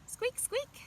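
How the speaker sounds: put-on playful high mouse squeak for the kids. Mouse voiced in picture book.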